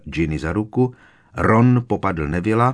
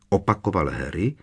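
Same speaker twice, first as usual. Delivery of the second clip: plain reading voice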